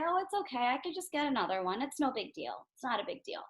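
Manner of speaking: soft, calm, no-big-deal reassurance. Acted. Teacher modeling the calm child after dropped ice cream, soft and easy. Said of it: "You might be really calm".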